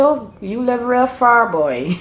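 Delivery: mock amazement at how far; incredulous islander. A Bajan reacting to someone from Saint Lucy, incredulous. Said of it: "they say".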